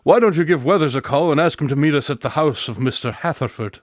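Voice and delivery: normal talking voice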